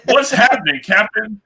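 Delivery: high, loud cheesy sidekick quip; sidekick one-liner. Retold or imitated. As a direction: toss it off like a comic-relief sidekick. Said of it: "He gets one-liners".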